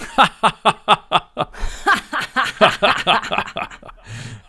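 high, loud villain cackle; diabolical laugh. Pretend. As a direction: cackle like an evil mastermind. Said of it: "Komm, ein diabolisches Lachen".

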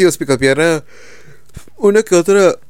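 words stretched by a yawn. Real, not acted. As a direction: talk through a big yawn. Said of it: "perdón por el bostezo".

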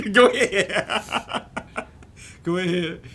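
high, slow, laughing through a mock go ahead; laughing mock urging. Teller laughing while voicing the woman's go ahead. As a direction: giggle it out high and drawn out.

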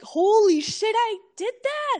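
high, elated exclamation; giddy, triumphant shout of relief; thrilled disbelief. Retold or imitated. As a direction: cheer it like you just survived the jump. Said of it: "and I was like".

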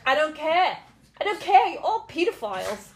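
loud, high mock shout of I don't care; mock Ricky Gervais rant. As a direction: yell it like a gleeful host insulting everyone. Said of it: "He's like".

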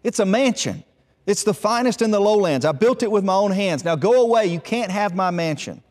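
defiant quarrel. Acted. Hut brother defending his mansion against the eldest, defiant. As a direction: drive the stranger off with acted indignation.

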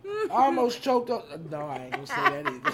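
laughing with food in the mouth; mouth full. Real, not acted. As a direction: giggle through a mouthful of chicken. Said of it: "food in my mouth".

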